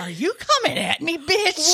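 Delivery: loud, high challenge with laughter in it; hammed-up outraged shout; mock confrontation. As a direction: call them out, half laughing. Acts out mock challenge to therapist.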